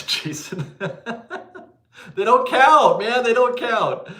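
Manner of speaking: loud, high-pitched exclamation through laughter; laughing protest. Real, not acted. Streamer protests to a generous viewer that it doesn't count, laughing protest.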